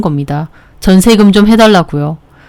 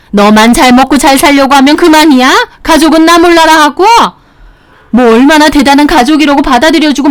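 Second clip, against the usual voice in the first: higher-pitched arguing between mother and wife; heated quarrel voices; 8 semitones higher than usual. Acted. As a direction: keep the raised family fight going. Narrated quarrel continues. (높은 톤의 말다툼)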